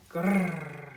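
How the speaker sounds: rolled animal growl; crocodile growl. A narrator's reading. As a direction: pretend snarl with a rolled r. Crocodile growls at Tick Bird.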